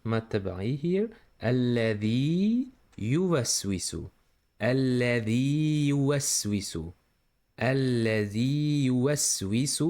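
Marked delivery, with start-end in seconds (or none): 1.4-9.8 الذي يوسوس with the long vowel stretched; natural stretching. Shown as a demonstration. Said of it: "You should stretch this voice here".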